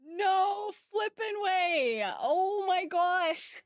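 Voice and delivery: high, drawn-out amazement; stunned disbelief. Real, not acted. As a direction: stretch each word in real astonishment. Overwhelmed by a surprise gift.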